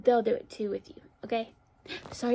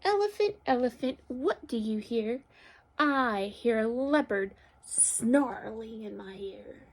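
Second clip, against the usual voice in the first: picture-book read-aloud; slower than usual. A narrator's reading. Teacher reads animal story to children, expressive storytelling. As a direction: call the animal, then stretch the sound word.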